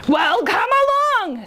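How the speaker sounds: high, slow, defiant call; big goat's loud voice. A narrator's reading. Biggest goat challenges the troll, slow defiant call. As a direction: dare him to come on. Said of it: "as loud as the troll's".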